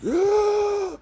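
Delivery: high, loud mock scream of rage; mad scream. Retelling Superman enraged after Lois dies, mad scream. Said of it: "he gets mad. He's like".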